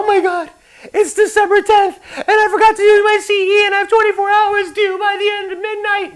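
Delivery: high, loud mocking panic; panicked student. Instructor mimicking a student calling Kelly in a panic, high shrill mock. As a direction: squeal it like a frantic last-minute student. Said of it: "sick and tired of you guys calling Kelly".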